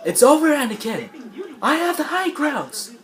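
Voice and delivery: high, hammed-up shouted warning; Obi-Wan. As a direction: call it out high and urgent. Obi-Wan warning Anakin at fight's end.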